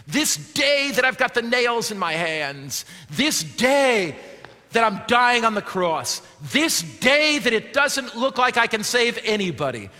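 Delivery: loud, pleading Jesus from the cross; fervent Jesus. Acted. Pastor voicing Jesus to the thief, loud and fervent. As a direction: lift the voice as Jesus promising the dying thief. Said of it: "Jesus is saying to that thief".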